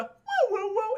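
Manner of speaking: high hooting chirp; little creature's high warble; imitated dinosaur call. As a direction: hoot like a small dinosaur.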